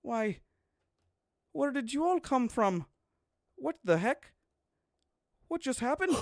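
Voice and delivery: high, startled woman's voice; surprised pregnant woman. Acted. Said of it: "she looks at you all and she says".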